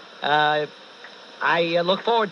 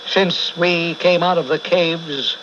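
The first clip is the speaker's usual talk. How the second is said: preaching. Acted. Host preaches about human effort.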